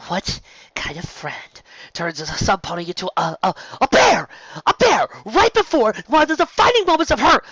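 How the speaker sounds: building to a high loud shriek; rant, rising into a scream; angry friend bearing down on Trixie; furious rant. Acted. Bon Bon ranting furiously.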